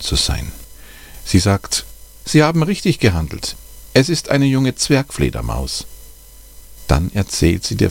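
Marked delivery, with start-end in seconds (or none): 2.2-5.9 higher, careful voice of the bat expert; the expert on the phone, voiced higher, patiently explaining; kind expert's advice. A narrator's reading. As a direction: talk the caller through it step by step, kindly. Expert praises the family.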